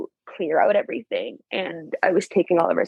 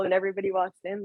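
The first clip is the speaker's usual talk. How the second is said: ordinary delivery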